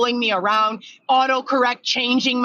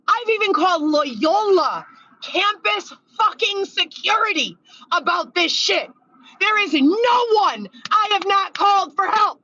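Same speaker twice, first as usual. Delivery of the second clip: exasperated; 10 semitones higher than usual. Real, not acted. Exasperated rant about no help.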